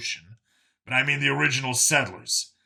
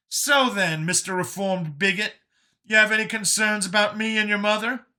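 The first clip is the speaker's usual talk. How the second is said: high-pitched, pointed character voice; Brian's teasing challenge; 10 semitones higher, 4 dB louder than usual. Acted. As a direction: needle him in Brian's raised voice. Brian questions Vincent pointedly.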